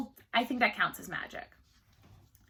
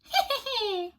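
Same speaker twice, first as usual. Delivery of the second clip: evil cackle; 11 semitones higher than usual. A narrator's reading. Imp laughs after taunt.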